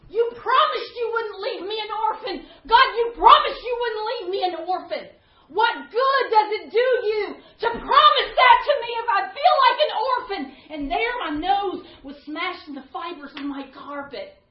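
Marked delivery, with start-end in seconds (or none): 0.1-10.6 louder, higher anguished prayer, partly reenacted; retold crying out to God. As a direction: plead with God, feeling abandoned. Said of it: "I was crying out to God".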